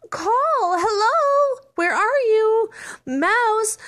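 high, loud searching call. Acted. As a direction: shout for the missing mouse. Searching mice calling out.

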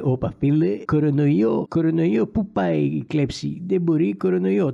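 put-on foreign accent in Greek; mock immigrant accent. Immigrants calling off thieving over coronavirus, comic immigrant accent. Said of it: "κάνω αυτή την, ε, προφορά".

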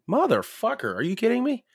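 high mock outrage; exasperated. Narrator told there is no Corona, mock outraged. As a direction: pitch jumps up in disbelief.

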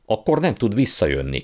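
put-on boy crying out that Polly is stranded; mild cry. A narrator's reading. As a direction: call out in dismay. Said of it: "kiáltotta Digori".

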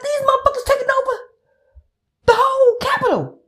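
high, quick alarmed retelling; urgent telling. Retold or imitated. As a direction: rush it out like breaking news to a friend. Herself urgently telling friends.

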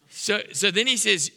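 usual speaking voice

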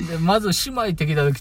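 normal talking voice

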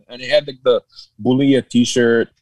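normal talking voice

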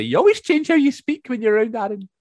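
high, loud imitation of family; retold family remark. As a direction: pipe up as the relatives calling it out. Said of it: "my family and stuff would always say".

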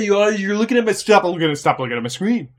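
put-on high, fast whiny kid accusation; whiny tattling little sibling. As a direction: complain like a bratty little sibling. Acted sibling quarrel continues.

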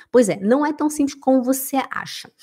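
whispered aside; conspiratorial whisper. Acted. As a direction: lean in and whisper it. Whispered reply.

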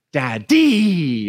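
Daddy as tadi, a falling toddler imitation; a light imitation of a toddler saying Daddy; toddler's odd daddy. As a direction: mimic the baby's weird Daddy. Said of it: "the way he says it, it's gonna sound weird".

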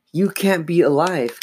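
high-pitched disbelieving cry; exclaiming. A narrator's reading. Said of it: "Chris exclaimed".

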